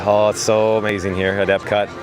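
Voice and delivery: ordinary delivery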